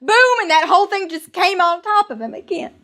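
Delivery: high, heightened cry of disaster; alarmed climax of a tale; urgent storytelling. A narrator's reading. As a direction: carry the panic through the second cave-in. Telling the second collapse.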